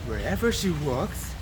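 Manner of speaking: ordinary delivery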